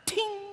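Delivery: bright high ting sound for a shiny sword; ping it out like light flashing off a blade; high mock sword ting. Carrot mimics a kingly sword catching light, high ting.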